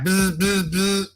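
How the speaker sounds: short repeated buzzes with the mouth; vocal buzzer noise; imitated buzzer. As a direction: buzz like a door intercom. Imitates the apartment buzzer ringing.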